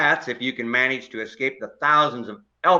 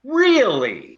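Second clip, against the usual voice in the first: a much higher, louder, startled "Really?"; shocked disbelief; 13 semitones higher, 5 dB louder than usual. Acted. Neighbour hears the offer to move in, shocked disbelief. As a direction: jolt up in pitch, caught off guard.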